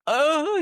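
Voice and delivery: dramatic groan. Retold or imitated. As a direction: flop like a soccer player. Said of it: "reenacting like he just lost his leg".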